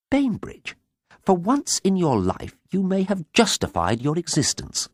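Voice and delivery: high-voiced, imperious sister; haughty declaration. A narrator's reading. Sister grudgingly praises her brother, high declaring voice. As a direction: pronounce it grandly, pitched high. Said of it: "she declared".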